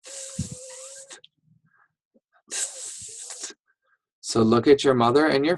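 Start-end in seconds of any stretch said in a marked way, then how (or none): 0.0-3.5 a soft breathy "th-" with tongue between the teeth; just the airy "th-" alone, no vowel; th sound. Shown as a demonstration. Teacher drilling "th-" for kids and parents, soft and airy. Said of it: "Just say th".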